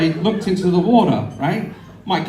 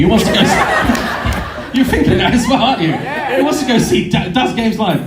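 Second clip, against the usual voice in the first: mock announcer call; 6 semitones higher, 3 dB louder than usual. Mocking audience reaction to his picture.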